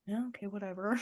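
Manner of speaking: resigned shrug. Retold or imitated. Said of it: "it's like".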